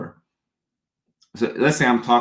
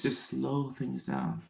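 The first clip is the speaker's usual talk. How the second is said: slow, much softer, calming; slow limbic voice; 2 semitones higher, 11 dB quieter, much slower than usual. Shown as a demonstration. Coaching a viewer to slow down, slow and soft. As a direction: ease it down gently, almost murmured. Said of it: "Use your limbic voice".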